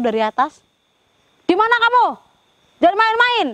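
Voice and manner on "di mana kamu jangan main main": high, loud shout into the dark; shouted command. Real, not acted. Ghost hunter yells at the spirit in the forest, high loud shout. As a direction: bark the order out to the hidden spirit.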